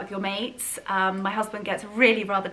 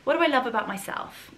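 usual speaking voice

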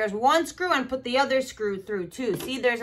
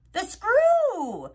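excited answer; 7 semitones higher, 3 dB louder than usual. Acted. Cheering the right answer.

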